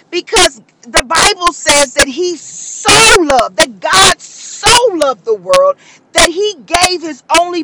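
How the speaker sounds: high, charged sermon delivery building to a cry; impassioned preaching. Acted. Preacher moved to tears preaching the sacrifice, fervent. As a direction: preach it with full conviction, voice climbing.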